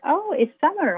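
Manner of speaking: mildly acted matter-of-course acceptance; retold acceptance. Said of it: "stop complaining, I'm like".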